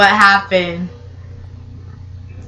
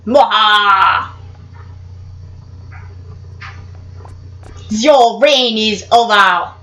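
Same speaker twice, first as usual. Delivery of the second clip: mustache twirling villain; slower than usual. Acted. Gloating after eating a rival.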